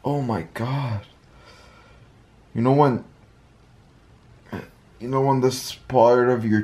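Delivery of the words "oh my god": ordinary delivery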